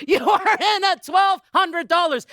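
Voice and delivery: loud, high, laughing as she announces the bid; host shouting a bid through laughter; excited laughing shout. Real, not acted. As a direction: cheer the new bidder.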